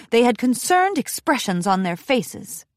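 ordinary delivery

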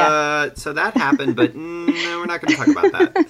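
high, hammy denial voice; mock awkward voice. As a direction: play it like nervous avoidance. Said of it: "Everybody's like".

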